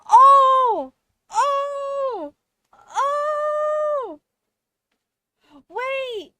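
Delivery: very high, loud shouted realization; ecstatic realization. Real, not acted. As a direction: yell out as it all clicks. Streamer's big realization in game.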